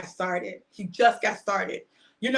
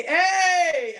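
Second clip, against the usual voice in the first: mock surprise; 9 semitones higher, 4 dB louder than usual. People reacting on meeting you.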